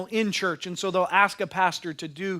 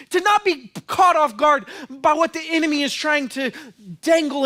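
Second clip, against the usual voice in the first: higher, louder earnest plea; pleading voice of God; 8 semitones higher, 5 dB louder than usual. Acted. God pleading with believers to share. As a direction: plead as God sending his witness. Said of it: "God is saying".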